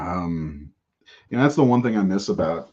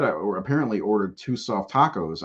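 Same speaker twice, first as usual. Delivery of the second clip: ordinary talk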